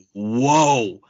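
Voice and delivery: loud drawn-out whoa; retold shock; stunned whoa. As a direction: react in disbelief. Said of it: "I was like".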